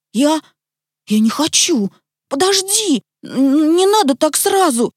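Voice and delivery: high-pitched, halting protest; flustered stammering. A narrator's reading. Petya begs Tik-Tak to wait, flustered. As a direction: stumble over the words, pleading to wait. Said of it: "забормотал Петя".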